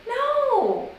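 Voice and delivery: high, drawn-out no; incredulous high-pitched no; exasperated denial. Acted. Denies being from Pakistan.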